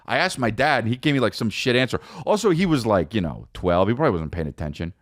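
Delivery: ordinary delivery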